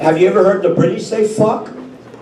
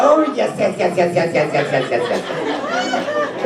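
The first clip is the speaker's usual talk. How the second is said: Julia voice; 4 dB quieter than usual. Acted. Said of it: "It goes something like this".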